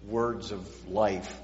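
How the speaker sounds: normal talking voice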